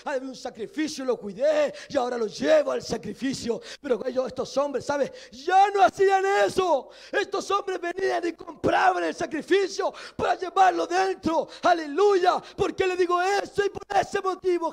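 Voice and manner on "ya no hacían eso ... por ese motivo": loud, ringing sermon voice, higher and louder; preaching at full force. Acted. Preacher urging the congregation to praise God fully, shouting. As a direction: preach it out to the whole church.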